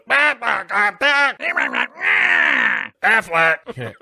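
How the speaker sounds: high, loud squawking duck; Gilbert Gottfried. Acted. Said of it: "R I P Gilbert Gottfried".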